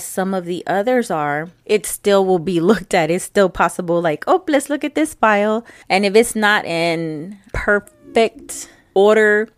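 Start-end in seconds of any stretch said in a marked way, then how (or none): 4.3-5.6 higher, picky auditor voice; mock auditor. Imitating an auditor checking her files, higher and picky. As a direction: play the auditor pouncing on a file. Voicing an auditor picking a file.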